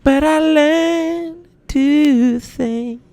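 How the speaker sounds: a comedian mimicking the singer, very high and slow. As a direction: float it up high and slow like a dreamy pop star. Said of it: "When he did the [name] thing".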